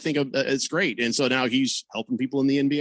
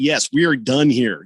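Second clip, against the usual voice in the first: ordinary talk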